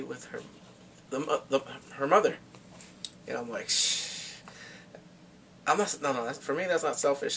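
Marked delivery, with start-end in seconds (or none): 3.7-4.3 long unvoiced "shh" noise; hushing shh. Telling viewers their reaction, a breathy shushing sound. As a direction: let air out in a drawn shh. Mimes own reaction to the scene.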